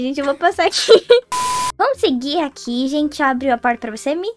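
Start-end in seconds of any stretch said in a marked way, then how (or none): 1.0-1.7 high squealing laugh. Real, not acted. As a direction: burst into a high shrieking laugh. Ri da confusão no jogo. (risada aguda e estridente)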